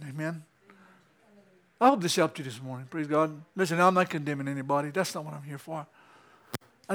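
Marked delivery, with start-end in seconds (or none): none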